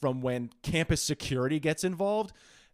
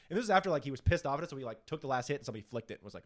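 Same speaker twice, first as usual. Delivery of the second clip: ordinary delivery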